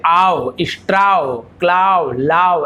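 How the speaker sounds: slow stretched आओ, स्ट्राओ, क्लाओ, लाओ with two vowels; wrong readings. Shown as a demonstration. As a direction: drag each word into a-o, the wrong way. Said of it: "जो कि गलत है". (गलत उच्चारण, आ-ओ खींचकर)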